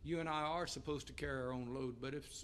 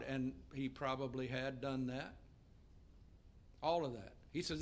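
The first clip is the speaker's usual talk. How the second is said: ordinary talk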